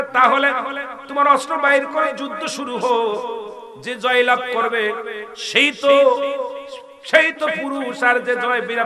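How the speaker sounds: high, loud fiery sermon voice; preaching at full force. Acted. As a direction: preach it out with fire. Preacher in full force telling story.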